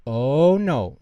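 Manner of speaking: loud, exasperated groan of a father; a dad who can't believe it; dismayed outburst. Acted. Father sees the junk food.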